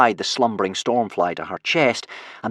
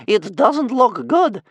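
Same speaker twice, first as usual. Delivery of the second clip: put-on old woman voice; 11 semitones higher, 5 dB louder, slower than usual. A narrator's reading.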